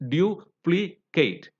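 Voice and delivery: "duplicate" slowly in three parts, du, pli, cate; segmented syllables. Shown as a demonstration. Teacher segmenting the word for learners, slow syllable by syllable. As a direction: break "duplicate" into separate syllables with pauses. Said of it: "segment it".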